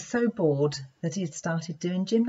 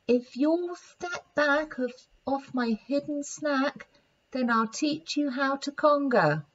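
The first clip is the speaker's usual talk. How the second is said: whispering monkey; 6 semitones higher than usual. Acted. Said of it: "whispered into her ear".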